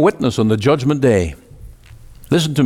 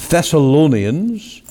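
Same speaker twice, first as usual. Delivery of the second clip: ordinary talk, nothing marked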